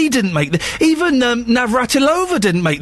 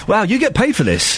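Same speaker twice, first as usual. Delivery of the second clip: ordinary talk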